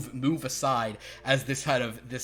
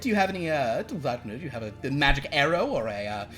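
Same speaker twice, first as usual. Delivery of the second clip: posh fae voice; 3 semitones higher, 4 dB louder than usual. Acted. Galliard pleads with Rena.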